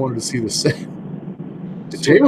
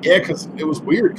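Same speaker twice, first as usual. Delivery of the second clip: normal talking voice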